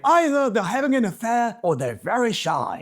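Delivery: put-on a hammy stab at an English accent; attempted English accent. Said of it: "I'm gonna do my best English accent here".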